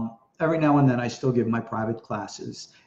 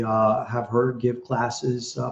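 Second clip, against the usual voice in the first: ordinary talk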